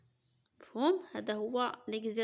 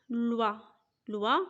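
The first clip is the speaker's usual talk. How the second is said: normal talking voice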